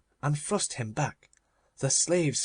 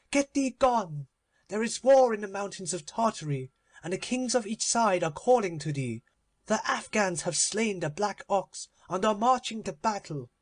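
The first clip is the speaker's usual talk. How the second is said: Avarice shrieking over her dead servants, much higher; cried aloud; 7 semitones higher than usual. Acted. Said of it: "she cried".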